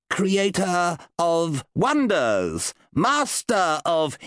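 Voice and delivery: slow, high flattering invocation; improvised incantation. Acted. Wizard inventing flattering spell words, slow and lofty. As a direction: lay the praise on thick, drawn out. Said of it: "he would have to improvise".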